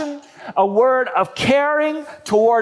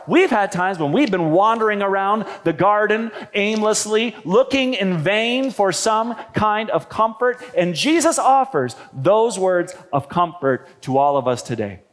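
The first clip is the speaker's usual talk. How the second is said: ordinary talk, nothing marked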